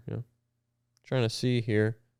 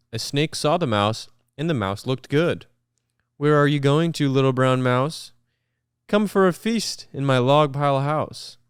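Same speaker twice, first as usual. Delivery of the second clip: big, lively read-aloud voice; animated picture-book narration with voices; storybook reading; 3 semitones higher, 5 dB louder than usual. Acted. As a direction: read it like to a room of kids.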